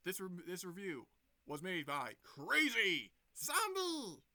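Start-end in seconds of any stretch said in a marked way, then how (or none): 2.2-4.2 slow, loud, high shout of the name; showy name announcement. Pretend. Announces own reviewer name loudly.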